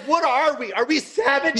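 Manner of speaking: high-pitched comic indignation; mock outrage. Comic replaying objection to the promoter, mock outrage. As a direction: plead in high, exaggerated disbelief.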